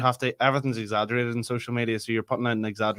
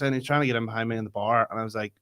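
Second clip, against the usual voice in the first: usual speaking voice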